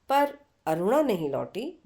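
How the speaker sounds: plain reading voice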